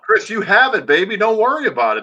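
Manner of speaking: hammed-up play-by-play voice; imitating Chris. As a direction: reassure him in his own announcer tone. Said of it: "I'm trying to be you".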